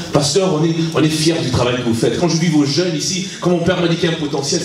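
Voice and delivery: normal talking voice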